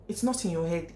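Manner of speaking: usual speaking voice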